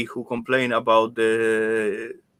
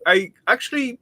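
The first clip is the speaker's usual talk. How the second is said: ordinary delivery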